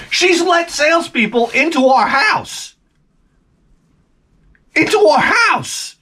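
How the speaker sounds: high, slow outraged repeat. Real, not acted. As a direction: rant it up high in disbelief.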